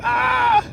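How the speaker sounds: short, loud, very high mock scream; demonstrating a sudden loud cry; high-pitched yell. Shown as a demonstration. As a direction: let out a mock panicked shriek. Mimics how not to react.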